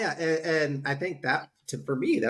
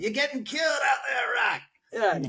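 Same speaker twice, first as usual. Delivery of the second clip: high, slow shouted Adrian voice; much higher mock shout to the boxer; claymation Adrian; 12 semitones higher than usual. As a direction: shout it from the corner like a cartoon Adrian. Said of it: "He's like".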